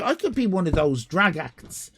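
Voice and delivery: ordinary talk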